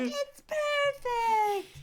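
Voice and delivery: very high, drawn-out mock squeal; hammed-up thrilled singsong; gushing delight. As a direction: gush over it slowly, way up high. Acting out Jamie's delighted reply.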